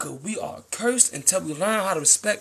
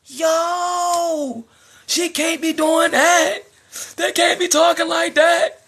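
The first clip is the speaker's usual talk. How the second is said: high, slow mock complaint; mocking whiny guy; 9 semitones higher, 4 dB louder, much slower than usual. Mocking upset men who complain about women rappers.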